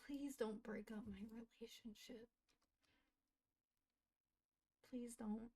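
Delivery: soft whispered plea; whispering a plea to save the relationship; pleading whisper. Pretend. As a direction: beg in a hush. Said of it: "she's like".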